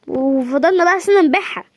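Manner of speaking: ordinary talk, nothing marked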